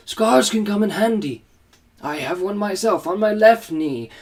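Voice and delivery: put-on high, kindly old headmaster; lightly voiced wise old wizard. Dumbledore voiced in story.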